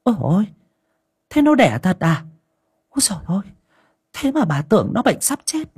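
put-on high, glad old woman's cry; old mistress overjoyed that her cat gave birth; delighted exclaiming. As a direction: burst out in happy relief. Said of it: "mừng rỡ kêu lên".

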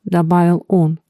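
plain reading voice

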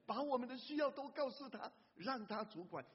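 fervent call to tell Jesus; urging. Acted. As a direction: press the need on them. Preacher urging congregation.

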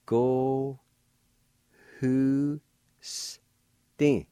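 "Go, hu, s, tin" said syllable by syllable; "Go hu s tin" one syllable at a time; Cherokee syllables. Shown as a demonstration. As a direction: sound out "Go, hu, s, tin" slowly. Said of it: "First part is pronounced like this".

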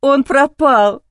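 slow, loud tearful cry; sobbing it into the phone. A narrator's reading. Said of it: "заплакала в трубку".

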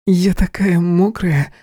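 slow, high purr. Acted. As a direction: purr it out coyly. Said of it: "мурлыкала девочка".

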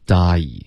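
die sliding from a to i; teacher models the diphthong; gliding die. Shown as a demonstration. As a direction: glide die slowly. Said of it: "所以也是一个双元音".

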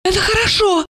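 high, agitated woman's voice; nervous tipsy relief; feverish muttering. A narrator's reading. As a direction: babble it feverishly, pitched high. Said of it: "лихорадочно забормотала Ольга".

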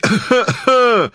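harsh cough that breaks the shout; hacking cough. A narrator's reading. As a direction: choke on a rough cough mid-cry. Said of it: "кричал сиплым голосом". (надсадный кашель)

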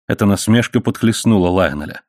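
plain reading voice

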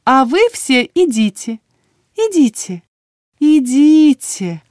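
high, loud, slowing trill; singsong shooing. A narrator's reading. Healer sends everyone out, lilting singsong.

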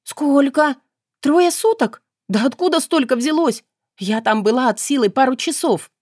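blink in shock and protest; bewildered rising outcry; astonished. A narrator's reading. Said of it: "изумлённо моргнуть".

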